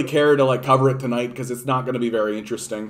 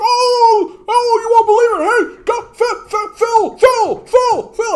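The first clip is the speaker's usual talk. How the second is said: frantic falsetto calling out; Bubbles; 6 dB louder, slower than usual. Acted. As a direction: squeal loudly for a friend while running up. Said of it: "runs down the street".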